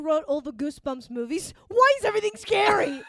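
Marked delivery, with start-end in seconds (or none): 1.7-3.0 high, loud mocking yell; mock indignant shout. Mocking a critic of the Goosebumps writer, loud and high.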